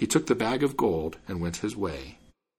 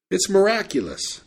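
higher-pitched exclamation of wonder; exclaiming; 9 semitones higher than usual. A narrator's reading. Man marveling at a small singing bird, exclaiming.

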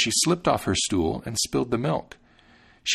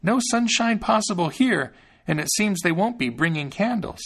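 put-on high, small voice of a thumb-sized boy; little Thumbling, fretful; Thumbling's tiny voice; 10 semitones higher than usual. A narrator's reading. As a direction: pitch it up like a tiny hero.

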